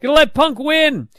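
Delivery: high excited fan voice; mock crowd cheer. Host acting out the crowd, excited high cheer. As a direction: whoop it like a hopeful fan. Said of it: "everyone's like".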